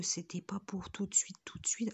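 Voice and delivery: breathy whisper of the imitated director; teasing imitation turned to a whisper; whispered aside in mock voice. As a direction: drop to a confiding whisper. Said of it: "ma petite voix que je viens de prendre".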